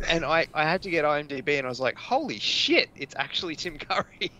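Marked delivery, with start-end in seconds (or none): 1.9-4.3 a high-pitched burst of mock astonishment at the start; retold surprise. Host retelling finding Tim Curry on IMDB, mock astonished. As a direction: pitch the first words way up in disbelief.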